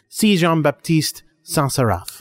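slow, hammed-up French accent on the name; lightly French-accented name reading. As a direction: savour the name in mock French. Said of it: "that ridiculous French accent".

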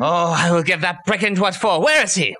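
theatrical outrage rising in pitch; blustering demand for the culprit; indignant outburst. Acted. Listener hunts whoever dug up mother, theatrical indignation.

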